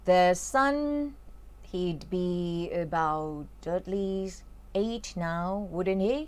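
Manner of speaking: slow, careful read-aloud of the story. Acted. Teacher reading a Harry Potter passage slowly to students. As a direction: read each line slowly for learners.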